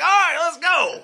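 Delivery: high-pitched, gung-ho reply; eager rallying cry. Acted. Friend answering news of free Doritos, eager and high. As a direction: jump at it, pitch shooting up.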